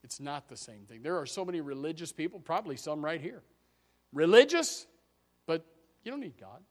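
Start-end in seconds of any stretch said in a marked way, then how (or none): none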